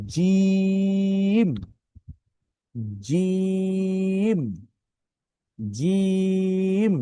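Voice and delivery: জিম held long, high and firm; firm jim. Shown as a demonstration. Teacher drilling a firm, drawn-out জিম. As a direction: stretch জিম out, strong and steady. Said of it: "শক্ত এবং মজবুত আওয়াজে পড়তে হয়".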